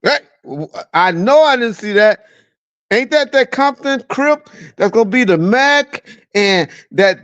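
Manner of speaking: ordinary talk, nothing marked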